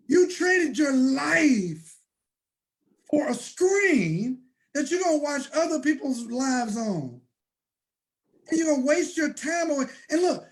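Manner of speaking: preacher's fervent cry about wasted time; impassioned shout. Real, not acted. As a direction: preach it hard, near breaking. Impassioned rant about wasted life.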